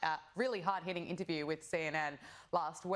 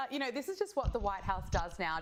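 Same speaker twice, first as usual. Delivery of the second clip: normal talking voice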